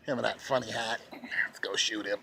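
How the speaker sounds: unvoiced mock whisper, scheming. Mocking a plotting official, scheming whisper.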